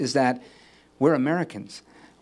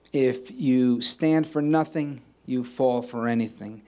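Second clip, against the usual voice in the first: slow quote; slower than usual. Shown as a demonstration. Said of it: "close by quoting Hamilton".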